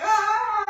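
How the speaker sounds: high, loud retold shriek; panicked scream. She greets her husband with a loud panicked shriek. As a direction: shriek in mock terror.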